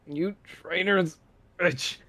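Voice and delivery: high, slow snarl through the strain; strained angry curse. Acted. Vatar cursing Sona while being squeezed, high and strained. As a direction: spit it out while being crushed. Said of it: "begins to grunt as he squeezed".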